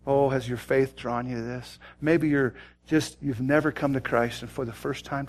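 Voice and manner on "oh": ordinary talk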